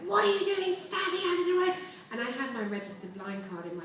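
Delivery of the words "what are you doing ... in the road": shouted high and loud at the careless pedestrian; angry cabbie. Retold or imitated. Cabbie yelling at the blind woman he hit, furious shout. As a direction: bellow it like a shaken taxi driver who just hit someone. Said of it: "He was so angry".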